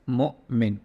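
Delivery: "Mu'min" with a small gap between Mu and min; "Mu'min" in two distinct beats; slight pause mid-word. Shown as a demonstration. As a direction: break "Mu'min" briefly after its first syllable. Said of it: "just a slight pause and then Min".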